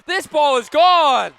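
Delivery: radio announcer roaring a home run; home run shout. Real, not acted. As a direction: belt out the home run call. Live home run call.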